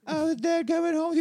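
put-on kid voice. Son on phone asking mother, high child voice. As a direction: pipe it up like a little kid. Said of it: "this is your mother".

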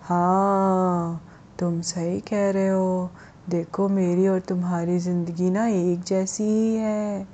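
slow, drawn-out talking stone; eerie, slow voice from a rock; mysterious stone voice. Acted. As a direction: stretch each word out like an unseen voice calling. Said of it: "एक आवाज़ आई और उसने बोला".

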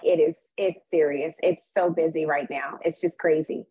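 ordinary talk

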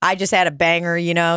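ordinary talk

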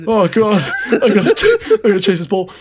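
high-pitched, hammed-up panic with a laugh in it; mock scared. Sergeant major realising he must chase the ball, mock scared. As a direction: imitate someone panicking as he turns to run. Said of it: "like, the scared fucking turn".

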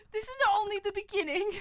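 high, quick cartoon voice; drifting into another character. Prisoner turning cartoonish, high character voice. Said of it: "I'm going into something else".

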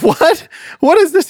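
startled. Acted. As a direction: jump up in pitch like the old man can't believe his ears. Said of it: "Saul's like".

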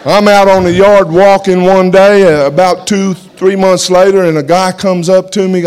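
fired-up testimony to the congregation; full-force preaching. Acted. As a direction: preach it to the back row.

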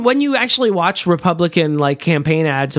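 ordinary delivery

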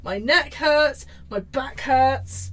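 slow mock whine. Mock complaining.

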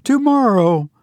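put-on high, emotional girl's voice; a hint of tears and laughter, pitched high; half laughing, half crying. A narrator's reading. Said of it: "half laughing, half crying".

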